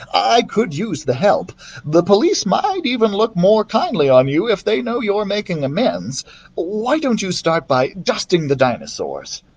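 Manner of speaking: lightly voiced museum curator, friendly; Lewis's voice. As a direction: offer the help kindly, with a hint of mischief. Lewis answering Thomas.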